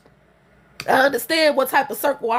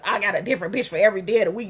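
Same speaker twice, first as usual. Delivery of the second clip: fast, lower, sneering mock quote; mocking his boast; 7 semitones lower, much faster than usual. Mocking his boast about many women, fast and sneering. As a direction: spit his boast back sarcastically. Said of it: "mocking his quote".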